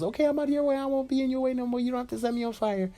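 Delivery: very high, rushed babble; panicked appeasing. Acted. Deku dodging Bakugo's threat, high hurried panic. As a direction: back off fast in a squeaky high pitch.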